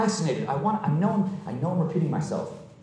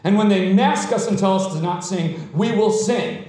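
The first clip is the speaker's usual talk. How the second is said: raised, emphatic sermon voice; higher-pitched, forceful pulpit delivery; impassioned preaching; 4 semitones higher than usual. Real, not acted. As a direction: proclaim it to the congregation, stretching key words.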